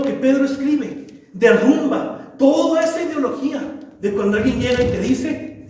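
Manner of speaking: ordinary delivery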